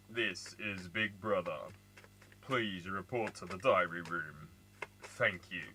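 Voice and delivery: Big Brother announcer. Retold or imitated. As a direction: intone it like the show's voice. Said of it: "It was Big Brother".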